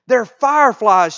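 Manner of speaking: loud, high exclamation; storybook shout. A narrator's reading. Oldest daughter spots twinkling lights, loud storybook shout. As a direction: shout the discovery out as a character. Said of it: "shouted the oldest daughter".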